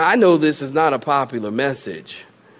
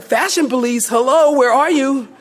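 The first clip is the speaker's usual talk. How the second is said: high-pitched mock appeal; mock calling out; 8 semitones higher than usual. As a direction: call out in comic disbelief, pitched up. Mock call to the fashion police.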